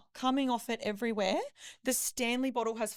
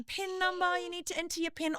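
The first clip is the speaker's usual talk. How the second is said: high insistent pin number; receptionist's prompt; 9 semitones higher than usual. Retold or imitated. Receptionist impatiently prompting her for her pin, high and insistent. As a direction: press her briskly for the pin. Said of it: "the lady at reception's going".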